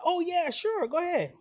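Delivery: high, breezy quoted agreement; high-voiced Holyfield. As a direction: agree readily in a high mock celebrity voice. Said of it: "It ain't sound like that".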